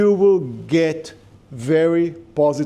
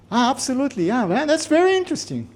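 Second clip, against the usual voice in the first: high, bright-pitched gushing "interesting"; polite fake enthusiasm; 9 semitones higher, faster than usual. Shown as a demonstration. Lecturer imitating a polite American gushing over an idea. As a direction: gush like an American being polite. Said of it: "the intonation of the word interesting".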